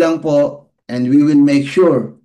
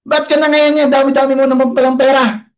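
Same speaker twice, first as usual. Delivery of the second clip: angry father; 9 semitones higher than usual. Retold or imitated. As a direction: scold the son over the coins. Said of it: "nagalit na naman yung tatay".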